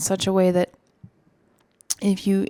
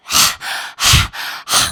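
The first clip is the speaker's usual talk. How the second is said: forceful panting out over the tongue; loud rapid huffing breaths, tongue out; lion's breath; 10 dB louder than usual. Shown as a demonstration. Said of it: "do a powerful breath of fire over the tongue".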